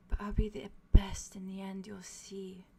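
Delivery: whispery, gentle reassurance. Acted. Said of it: "very, very gentle".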